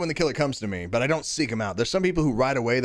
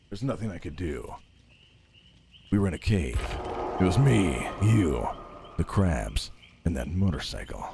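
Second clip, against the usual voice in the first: mock heartbroken lover; 9 semitones lower, 6 dB quieter than usual. Talking to the game character Eva, mock heartbroken lover. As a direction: ham up a betrayed lover, much lower.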